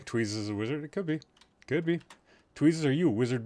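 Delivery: ordinary delivery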